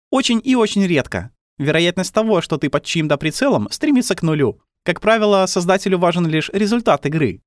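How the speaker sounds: put-on high, matter-of-fact girl voice; girl character. A narrator's reading. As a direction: voice a calm, knowing little girl.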